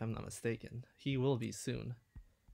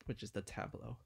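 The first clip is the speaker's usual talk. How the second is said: ordinary talk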